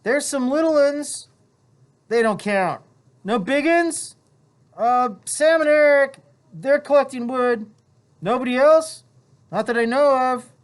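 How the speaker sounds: put-on high boyish voices, a little loud; two schoolboys calling across the beach; voiced boys talking. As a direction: play eager island boys. Two boys talk, voiced apart.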